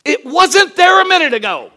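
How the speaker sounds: put-on loud, high, laughing little girl's protest; imitating the daughter's excuse with a laugh; animated daughter. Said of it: "she's very animated".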